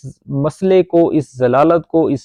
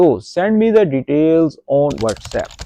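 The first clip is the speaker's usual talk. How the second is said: slow gloss; much slower than usual. Shown as a demonstration. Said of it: "English gloss read slowly".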